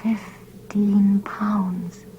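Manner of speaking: slow unvoiced whisper; dawning whisper. A narrator's reading. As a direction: breathe it out slowly as a whisper. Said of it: "Then he whispered it".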